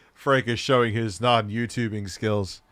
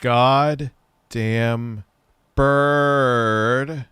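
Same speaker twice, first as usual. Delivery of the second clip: slow, drawn-out and louder on bird; mock outburst; 4 dB louder, much slower than usual. Friend yells at the video that he is a bird, slow and loud. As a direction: spell it out word by word like a grand insult.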